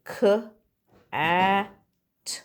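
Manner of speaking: each letter sound said separately, with pauses between. Shown as a demonstration. Teacher to pupils, sounding out a word letter by letter. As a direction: split the word into its single sounds for the class. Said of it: "you should be saying".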